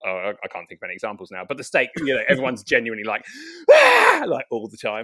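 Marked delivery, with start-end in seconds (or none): 3.7-4.2 loud, high mock shriek; hammed-up high scream. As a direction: shriek like a reality star losing it. Housewives screaming all the time.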